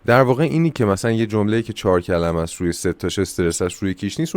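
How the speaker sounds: usual speaking voice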